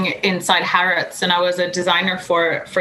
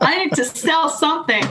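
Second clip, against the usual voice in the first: high, laughing, pleading; desperate; 7 semitones higher than usual. Retold or imitated. Broke saleswoman in London at month's end, high desperate plea. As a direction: squeak it like a frantic seller. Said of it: "desperate dot com".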